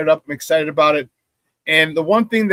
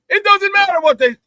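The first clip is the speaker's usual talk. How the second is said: high, raised-voice outburst; fired-up insistence; 12 semitones higher, 4 dB louder than usual. Real, not acted. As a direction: burst out, dismissing every objection. Fired-up pep talk, cutting objections off.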